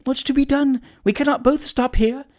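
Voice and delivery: very high, frantic woman's voice; distraught landlady, voice shooting up; partial derangement. A narrator's reading. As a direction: panic in a high, flustered pitch. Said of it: "in a state of partial derangement".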